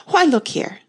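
high, loud exclamation; crying out. A narrator's reading. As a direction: call it out sharply. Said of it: "the Red Queen cried".

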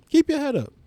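high-pitched encouraging cry; uplifting call. Shown as a demonstration. Comedian encouraging the audience, pitched high. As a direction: lift the listener up with a high call.

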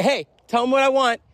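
high-pitched call to a student. Retold or imitated. Teacher cueing her student helper, calling out high. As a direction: call across the class to your helper.

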